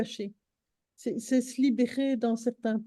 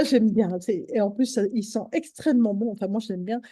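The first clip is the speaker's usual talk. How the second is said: ordinary delivery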